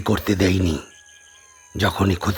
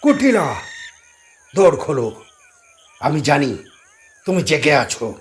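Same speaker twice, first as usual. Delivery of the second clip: calling out; 6 semitones higher than usual. A narrator's reading. Sadhu calls Kutila to open the door at night. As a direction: call her name from outside the door.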